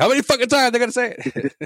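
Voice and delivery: high, incredulous listener voice; mock a fed-up listener asking; podcast host mocking annoyed listeners, exasperated. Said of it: "the listeners are like".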